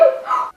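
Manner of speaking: quick startled intake of breath; sharp gasp. Real, not acted. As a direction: gasp as if something just caught your ear. Sudden gasp at kettle.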